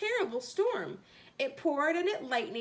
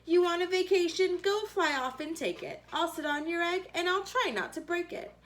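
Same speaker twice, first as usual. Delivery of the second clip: elephant voice; 7 semitones higher than usual. Acted. Elephant agrees to sit.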